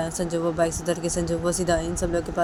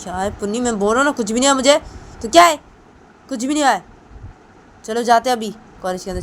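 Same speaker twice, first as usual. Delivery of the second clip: loud, higher, annoyed brush-off; irritated; 5 semitones higher, 6 dB louder than usual. Acted. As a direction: snap back that nothing is wrong. Sanju snaps at friends' questions. (चिढ़कर ऊँची आवाज़ में)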